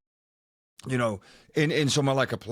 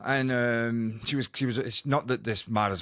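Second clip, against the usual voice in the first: usual speaking voice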